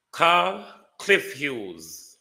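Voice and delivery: high, slow, drawn-out demand; slow emphatic call. Acted. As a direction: stretch every word out, rising. Host's escalating call-out.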